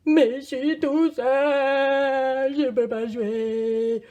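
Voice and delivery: loud, slow, drawn-out whining; hammed-up bawling in fed-up self-pity; mock tantrum wail. Cannot play the game alone.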